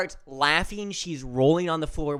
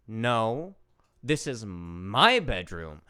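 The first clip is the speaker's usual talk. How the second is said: slow, lower imitation with a leaned-on MY; sassy child; 5 semitones lower, much slower than usual. Four-year-old claims the bedroom from her uncle-to-be, imitated sass. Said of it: "Stephanie has so much sass".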